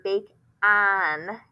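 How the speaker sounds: BAKE-AN with a drawn-out "ahn" ending; slow demo of the wrong "ah" in BAKE-AN; wrong short-vowel version. Shown as a demonstration. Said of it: "which is the short vowel sound".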